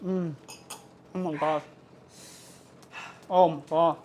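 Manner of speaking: talking with a mouthful; words through a bite of bread; chewing while talking. Real, not acted. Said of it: "I apologize for my chewing".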